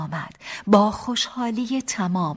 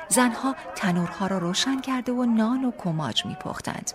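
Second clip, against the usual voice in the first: usual speaking voice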